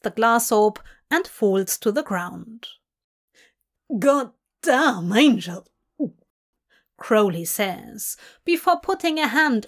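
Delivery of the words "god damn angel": loud stunned outburst; shocked exclamation. Acted. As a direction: blurt it in shock. Stunned by the knockout.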